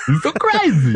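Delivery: high, loud, laughing through it; cracking up mid-mimic.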